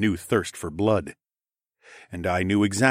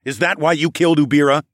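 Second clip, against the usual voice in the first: loud, higher interrogation; accusing question; 7 semitones higher, 7 dB louder than usual. A narrator's reading. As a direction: demand it of a pinned killer. Narrator's hero interrogates pinned killer.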